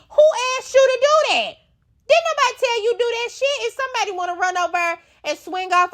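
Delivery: incredulous rant at a castmate; indignant outburst. Real, not acted. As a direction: yell who asked you in outrage. Ranting at Danny.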